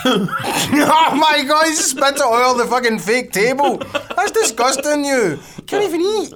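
high, slow laughing disgust. Real, not acted. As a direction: laugh through it in disbelief. Laughing at spilled Twix.

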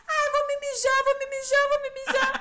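high, loud squeal through laughter; laughing shriek. Retold or imitated. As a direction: mom squealing she's about to wet herself. Mom laughing, about to pee.

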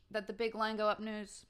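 normal talking voice

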